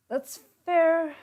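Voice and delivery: high, loud put-on voice; lightly voiced quip; mock voice. Real, not acted. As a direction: pop it out in a funny voice. Reaction in another voice.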